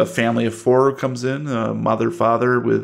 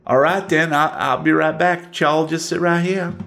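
much higher, sweet Southern waitress greeting; high drawling waitress voice; waitress Wilma; 5 semitones higher than usual. Acted. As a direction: greet them like a folksy old diner waitress.

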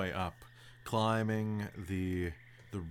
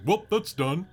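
careless mapmaker; 7 semitones higher, 7 dB louder than usual. Retold or imitated. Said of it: "they're like".

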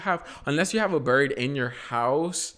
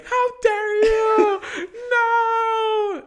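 much higher, louder, slow mock screaming; mimicking mom screaming much higher and louder; imitated scream; 8 dB louder, much slower than usual. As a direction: shriek it like an outraged mom. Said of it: "just screaming and being like".

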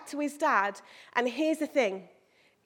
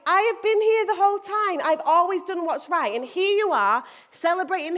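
higher, louder aggrieved protest; annoyed older brother; 5 semitones higher, 4 dB louder than usual. Acted. Older brother complaining about the celebration, annoyed and raised voice. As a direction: act out resentment at being passed over. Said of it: "He was annoyed".

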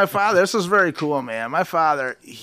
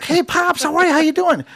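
chirpy son; 12 semitones higher, 3 dB louder than usual. Retold or imitated. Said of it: "I'm like".

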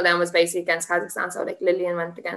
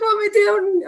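much higher, upset and near tears; tearful; 4 dB louder than usual. Retold or imitated. Fighter retelling her panic to the interviewer, high tearful cry. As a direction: ask it in despair, voice up high. I started crying.

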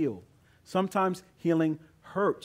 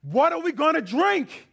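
much higher, louder complaining cry; angry grumbling demand; 13 semitones higher, 7 dB louder than usual. Retold or imitated. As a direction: hurl the question at the leader. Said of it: "they turn to their leader and they say".

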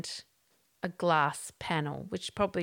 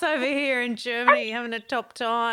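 loud, laughing mock cheer; giggling, loud ironic cheer; laughing sarcasm; 5 semitones higher, 9 dB louder than usual. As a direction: laugh through a sarcastic boast. Joking along about the awful trip.

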